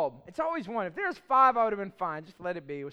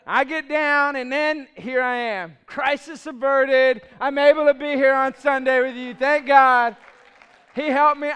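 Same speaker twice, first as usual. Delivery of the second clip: loud, joyful proclamation; triumphant relief; 3 semitones higher, 10 dB louder than usual. Real, not acted. Preacher telling the congregation he got down safely, loud and jubilant. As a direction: ring it out like a victory.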